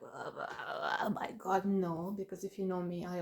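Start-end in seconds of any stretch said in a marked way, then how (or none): 0.0-1.6 mock negativity. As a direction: put on a woe-is-me groan.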